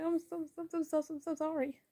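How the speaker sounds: high-pitched stammered mock-crying apology. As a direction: imitate a tearful character, pitched well up. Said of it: "like, crying".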